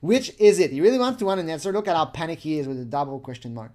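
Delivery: ordinary talk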